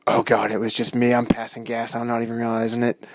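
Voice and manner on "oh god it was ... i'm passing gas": ordinary talk, nothing marked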